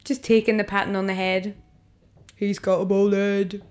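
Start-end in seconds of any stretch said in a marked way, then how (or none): 2.4-3.6 slow, drawn-out mock jeer; imitated drunken drawl at usual volume; drunk lad. Replaying the tube lads' taunt, slow mock jeer. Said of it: "very drunk".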